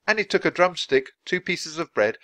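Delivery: ordinary delivery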